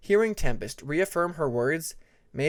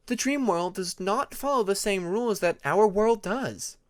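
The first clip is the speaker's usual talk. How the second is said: put-on higher, patient young dragon explaining; Tempest voice, explaining; 6 semitones higher than usual. A narrator's reading. Tempest explaining the dream world to her friends, lightly higher voice. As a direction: lay it out gently for friends, voice raised.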